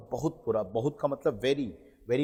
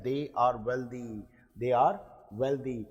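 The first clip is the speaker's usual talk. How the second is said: slow dictation; much slower than usual. Shown as a demonstration. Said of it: "teacher models sentence for practice".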